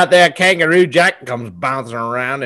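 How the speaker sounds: ordinary delivery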